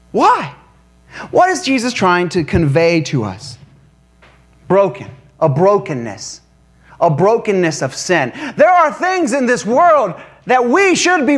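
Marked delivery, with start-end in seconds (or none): none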